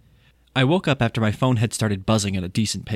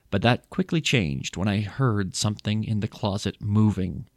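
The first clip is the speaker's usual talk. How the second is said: ordinary delivery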